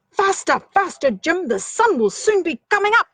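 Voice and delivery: excited shouting mouse voice. Acted. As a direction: hurry the eagle along, calling up high. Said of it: "shouted Dingle Mouse".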